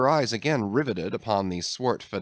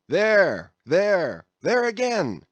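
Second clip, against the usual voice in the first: much higher, louder calls; mate hollering there she blows; excited urging; 7 semitones higher, 4 dB louder than usual. A narrator's reading. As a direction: spot the whale and rally the boys. Mate urging crew after whale.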